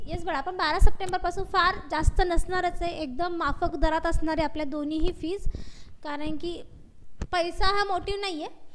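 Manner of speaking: speaking up over a weak line. Real, not acted. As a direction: raise the voice so the class can hear. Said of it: "ma'am, थोडा आवाज कमी आहे".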